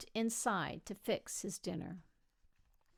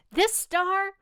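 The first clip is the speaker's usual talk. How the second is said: higher, louder voice of the loving wife; wife's voice; 8 semitones higher, 8 dB louder than usual. Acted. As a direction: speak up brightly as the old wife. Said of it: "she would always say".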